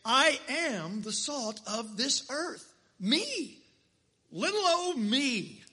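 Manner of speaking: a hint of a small, meek voice; lightly voiced little old me, gently self-mocking; slow, mock-modest "Little old me"; humble believer. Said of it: "look at this personally and say".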